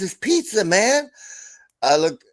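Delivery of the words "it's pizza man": put-on high, pleading kid voice; whiny kid. Son insists to his dad it's pizza, high and whiny. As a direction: whine it like a kid who wants pizza. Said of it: "He goes".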